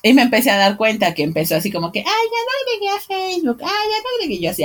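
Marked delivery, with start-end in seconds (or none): 2.0-4.4 much higher, soft, whiny little voice; mock the rival's squeaky voice; squeaky girly voice. Imitating her friend's new friend, mocking squeaky voice. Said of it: "tiene esa vocecita así toda pituda".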